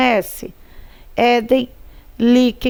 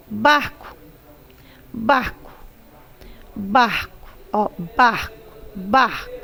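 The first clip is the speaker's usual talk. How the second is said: "barco" with a strong "bar" and "co" nearly dropped; stress on BAR; 3 dB quieter than usual. Shown as a demonstration. Teacher repeating for pupils, "barco" with the stress on "bar". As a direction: hit "bar", let "co" fade in "barco". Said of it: "O bar é a sílaba tônica".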